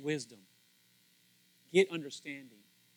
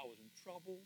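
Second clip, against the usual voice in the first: ordinary talk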